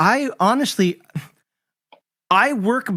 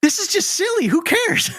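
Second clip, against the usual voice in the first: dismissive; 11 semitones higher than usual. Retold or imitated. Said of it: "you read it, and you're like".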